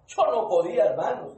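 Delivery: ordinary talk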